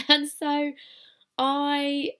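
ordinary delivery

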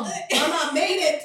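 loud, slow, high proud cry; excited. Retold or imitated. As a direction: shout it proudly to mom. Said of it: "I told my mom".

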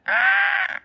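high falsetto shriek; a squealing Hagrid yelp; mock scream. Retelling Hagrid as the dragon hatches, high shrieking yelp. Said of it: "He's like".

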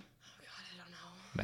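soft, breathy whisper to herself; whispered uncertainty. Real, not acted. Asked how many holes a straw has, she whispers her doubt. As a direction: murmur it under your breath, stumped.

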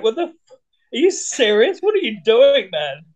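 playing an indignant, startled bedmate; exasperated partner. Imagined partner reacting to the morning whooping, exasperated.

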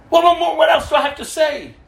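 angry retort. Retold or imitated. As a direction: throw the question back in a rage. Angry woman keeps yelling.

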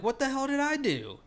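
high-pitched, exaggerated bewilderment; acted-out outraged disbelief; retold indignant protest. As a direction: protest your innocence in a high voice.

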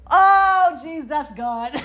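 high, loud outburst breaking into a laugh; reliving the fear. Real, not acted. Reliving the wolf scare, loud high exclamation. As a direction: cry out as the scare floods back. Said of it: "I'm reliving it".

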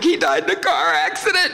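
high-pitched mock sobbing wail; fake crying. Boy lying to caller about dad, fake crying. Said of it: "and I'm crying".

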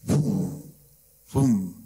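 low, falling boom; imitated rocket booms. Father recalls rockets landing during prayers, low imitated booms.

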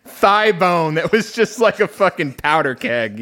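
talking through laughter; laughing. Real, not acted. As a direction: giggle the words out. Joking about a thick thigh bone.

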